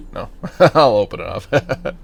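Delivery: amused, laugh breaking into the words. Real, not acted. Gamer reopening the helmet just closed, laughing. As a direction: chuckle through a change of mind.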